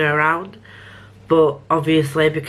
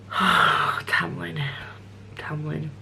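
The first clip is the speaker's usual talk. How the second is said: breathy whispered sigh of the name; exasperated whisper of a character's name; 6 dB quieter, much slower than usual. Real, not acted. Sighing the name to herself.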